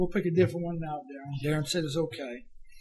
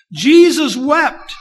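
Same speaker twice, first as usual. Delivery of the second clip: loud, very slow, higher proclamation; preacher booming the verse slowly; proclaimed; 7 semitones higher, 14 dB louder, much slower than usual. Acted. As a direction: ring out each word to the congregation.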